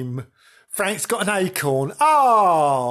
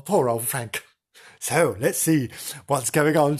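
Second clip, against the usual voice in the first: ordinary delivery, nothing marked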